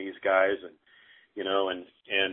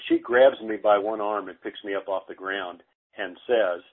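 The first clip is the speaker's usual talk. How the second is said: ordinary talk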